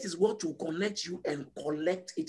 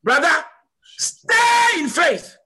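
very high, loud, long-drawn shout; impassioned appeal; 10 semitones higher, 9 dB louder, slower than usual. Real, not acted. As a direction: call out to each believer, then stretch the cry. Preacher calling on sister and brother.

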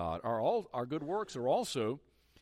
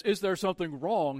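echoed back louder and higher, indignant; 5 semitones higher, 5 dB louder than usual. Retold or imitated. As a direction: throw the question back at her, offended. Old man echoes her question.

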